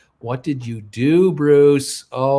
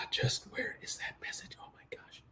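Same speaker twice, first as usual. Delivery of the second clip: whisper; 4 semitones higher, 22 dB quieter than usual. Real, not acted. Muttering to self while searching.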